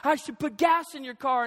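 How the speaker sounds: retold indignant question; mock arguing. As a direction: act out a husband questioning back in a quarrel. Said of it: "it went back and forth".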